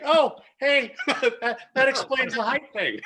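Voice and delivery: high and loud through laughter; laughing realization. Retold or imitated. Telling the Warriors hotel story, laughing high and loud. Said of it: "It's like".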